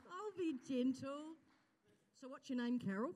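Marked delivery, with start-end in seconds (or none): 0.1-1.3 higher and slow, coaxing; lighter gentle promise. Real, not acted. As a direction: lift the voice to coax her up. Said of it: "Carol, just come here for a minute".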